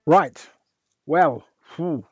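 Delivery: normal talking voice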